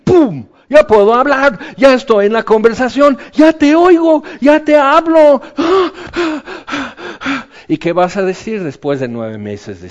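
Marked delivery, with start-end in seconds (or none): 0.0-5.4 loud, high, jubilant cry of release; bursting with joy. Acted. Zacarías suddenly finds his voice, jubilant shout. 5.5-7.6 amazed high gasp. Acted. Acting Zacarías.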